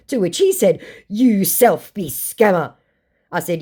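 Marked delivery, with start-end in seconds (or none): 1.1-2.7 slow, raised, louder impression; mock accusing scammer. Scammer throwing the accusation back, mock indignant. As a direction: draw out the accusation in a put-on voice.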